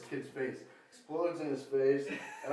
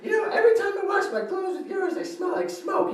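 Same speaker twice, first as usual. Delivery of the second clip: much higher mock sister voice; mock nagging sister; 3 dB louder than usual. Sister complaining about his smoky clothes, high whine.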